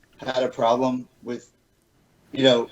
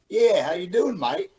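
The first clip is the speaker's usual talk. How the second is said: mock Aussie greeting, pitched up high; fake Australian accent; 8 semitones higher than usual. Mocking friends back from Australia, fake Australian accent. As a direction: ham up a put-on Australian twang. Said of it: "You do not have an accent".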